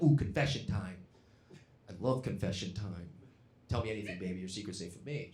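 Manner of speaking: soft, breathy come-on; seductive purr. Retold or imitated. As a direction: coo it like pillow talk. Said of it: "so I was like".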